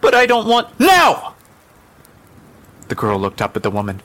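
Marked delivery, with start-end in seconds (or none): 0.0-0.8 high, loud whiny refusal; child's protest. Acted. 0.8-1.3 very high, loud shout; angry command. Acted. Mother orders child out.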